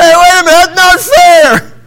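put-on kids protesting. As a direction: whine that it isn't fair. Boys protesting loudly.

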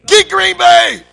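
loud, high sports-fan yell; full-out football shout; cheering fan. Acted. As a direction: holler it at the game. Acting a fan at football game.

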